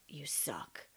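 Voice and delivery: harsh whisper of the voice in your head; a rough unvoiced whisper; inner critic whisper. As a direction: whisper the put-down like a nagging inner voice. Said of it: "there's this narration of".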